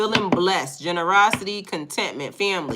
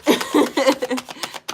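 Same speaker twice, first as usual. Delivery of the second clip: high burst of laughter; real, helpless high laugh; cracking up; 8 semitones higher, 4 dB louder than usual. Real, not acted. Streamer laughs at chat saying wicked, high laughter. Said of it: "I'm cracking up".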